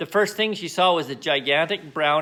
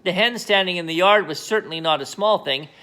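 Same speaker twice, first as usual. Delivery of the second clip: usual speaking voice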